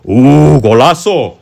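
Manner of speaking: loud, high golazo yell; excited goal shout. Real, not acted. Reacting to a goal in game.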